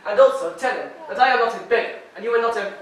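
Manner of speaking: insulted pride, voice rising; getting mad. Acted. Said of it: "don't get mad".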